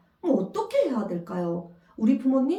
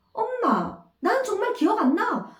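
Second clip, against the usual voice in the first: put-on higher childlike protest; a girl insisting to her mother she forgot; child whining; 10 semitones higher than usual. Child in retold quarrel.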